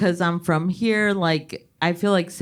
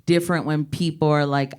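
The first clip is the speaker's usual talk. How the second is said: normal talking voice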